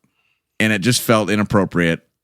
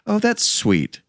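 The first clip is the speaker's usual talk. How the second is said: mom's sweet voice; 14 semitones higher, slower than usual. Retold or imitated. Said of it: "She's like".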